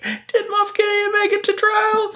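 very high, sing-song musing; hammed-up high-voiced pondering; mock wondering. Mocks a character wondering aloud.

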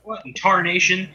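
put-on high startled old-timer; old prospector. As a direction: exclaim like a grizzled miner. Jeb character exclaims.

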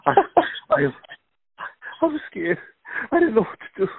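high, tearful whimpering victim; mock-weeping dog at special victims unit; traumatized dog voice. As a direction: play the scared dog testifying to detectives. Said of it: "and he says".

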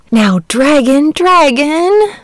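pleading, rising high on dragon; the boy pleads with his friend the dragon, imploring. A narrator's reading. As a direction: coax him, begging. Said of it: "said the boy imploringly".

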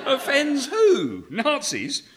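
high, slow laughing scoff; laughing disbelief. Real, not acted. Writer scoffing at the agent, laughing high.

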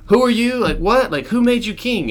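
high, loud, indignant mock yell; challenging peasants. Peasants challenging who made him king, indignant squawk. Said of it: "And they're like".

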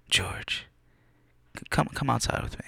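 soft, hushed summons; hushed boss. Retold or imitated. Carl calling him outside, in a soft hushed voice. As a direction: quietly call him aside.

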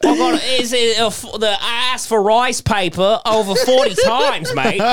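hammed-up Australian yob complaining about Korean barbecue; Australian bloke. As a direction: whinge about the restaurant service like an Aussie. Mock rant as the Australian man.

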